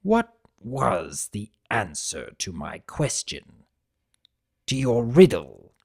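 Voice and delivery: low, gruff villain questioning; a hint of a grudging old tyrant; Tirek voice. Tirek asking Discord for the answer, low and grudging. Tirek asks about the riddle.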